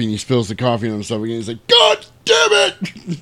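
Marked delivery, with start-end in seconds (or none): 1.7-3.2 very high, slow squealed curse; hammed-up curse. Sailor spilling hot coffee on himself, slow squealed curse. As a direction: stretch it out like scalded by coffee.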